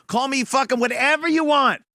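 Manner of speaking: ordinary delivery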